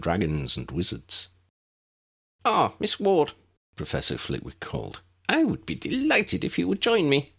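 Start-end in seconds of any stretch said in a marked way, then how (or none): 2.4-3.3 squeaky professor. A narrator's reading. Flitwick greets Lydia. 5.3-7.3 squeaky professor. A narrator's reading. Flitwick invites Lydia.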